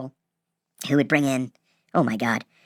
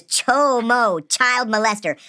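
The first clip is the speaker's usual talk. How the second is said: spelled out as if to an idiot, pitched up; talking down; 6 semitones higher, much slower than usual. Retold or imitated. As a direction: explain the obvious to someone stupid. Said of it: "He goes like I'm stupid".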